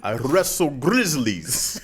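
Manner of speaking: slow, hammed-up Russian boast; Russian movie fighter. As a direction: boast slowly in a mock Russian accent. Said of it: "the Russian takes his jacket off".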